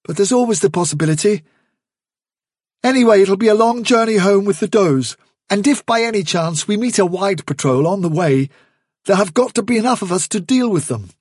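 put-on young leader addressing the warren; Hazel's calm resolve. Hazel voiced.

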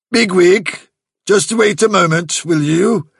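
high, loud call of command; summoning call. A narrator's reading. Chief rabbit calling Bigwig back, loud summons. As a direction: call out sharply to a subordinate.

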